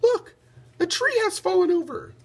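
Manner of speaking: put-on high little boy calling out; a boy calling his father to look; child's excited call. A narrator's reading. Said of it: "Radius shouted".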